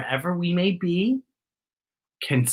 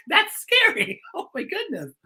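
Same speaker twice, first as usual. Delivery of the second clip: laughing; 12 semitones higher, 4 dB quieter than usual. Real, not acted. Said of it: "I can tell you what else is scary".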